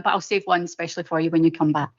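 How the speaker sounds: normal talking voice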